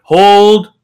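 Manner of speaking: loud barked halt; shouted command. Acted. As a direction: bellow it to stop them. Guard commands cart to stop.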